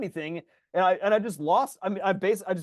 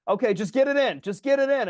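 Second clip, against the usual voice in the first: raised, pleading self-talk, higher; yelling to myself; 7 semitones higher, 5 dB louder than usual. Retold or imitated. Tennis player losing games, urging himself, raised voice. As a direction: urge yourself on mid-match, a little louder. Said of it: "I'm, like, yelling to myself".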